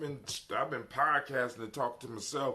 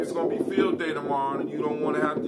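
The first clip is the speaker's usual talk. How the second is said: loud, higher, fast telling-off; 7 semitones higher, 13 dB louder than usual. Real, not acted. Scolds kids who are still awake.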